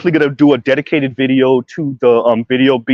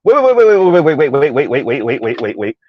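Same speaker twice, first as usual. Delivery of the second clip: fading wait. Real, not acted. Cutting in, fading repeats.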